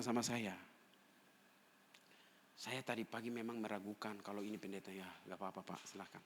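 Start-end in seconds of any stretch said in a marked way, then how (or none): 2.5-6.2 very soft, lowered retold exchange; quiet reassurance. Pastor retelling a visitor's apology and his pardon, hushed. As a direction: let it sink down almost to nothing.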